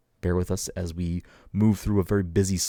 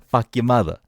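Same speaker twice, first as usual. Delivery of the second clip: louder, laughing mock Boston insult; Boston tough guy; 5 semitones higher, 6 dB louder than usual. Host laughing, doing a Departed-style Boston insult. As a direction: spit it like a Departed gangster, half laughing. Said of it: "The Departed".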